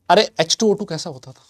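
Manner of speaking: ordinary delivery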